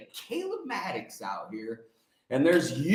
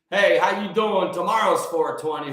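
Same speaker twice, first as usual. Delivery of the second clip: usual speaking voice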